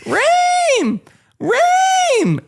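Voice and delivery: piercing high call of a name; loud sharp voice. Retold or imitated. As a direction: yell the name shrill and high from far off. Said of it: "I hear my mother's voice, piercing in my ear".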